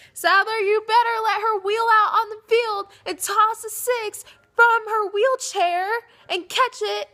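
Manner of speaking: high, loud, crying demand; tearful plea. Real, not acted. Pleading with Souther to let her march. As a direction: beg through tears for her to march.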